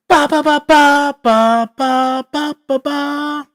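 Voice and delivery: put-on high, processed baby babble; childish voice effect. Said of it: "All those voice effects are lame".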